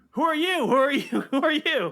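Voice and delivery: very high, loud robot squawk; two Alphas. Acted. Two Alphas meet in the same room, high squawking robot voices. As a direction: squeal it like two startled robots meeting. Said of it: "two Alphas in the same room and be like".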